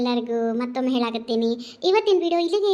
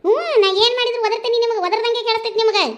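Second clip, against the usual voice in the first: higher, louder indignant retort; yelling back; 7 semitones higher, 6 dB louder than usual. Acted. Daughter yells back at her mother who says she always yells. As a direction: snap back at her loudly. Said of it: "ಎಲ್ಲದಕ್ಕೂ ಒದರ್ತಿ".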